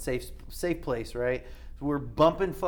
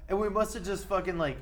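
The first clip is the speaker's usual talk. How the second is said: usual speaking voice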